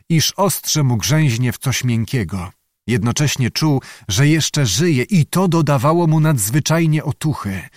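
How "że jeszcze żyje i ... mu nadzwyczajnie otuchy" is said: ordinary delivery, nothing marked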